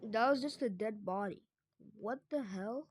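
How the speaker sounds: ordinary talk, nothing marked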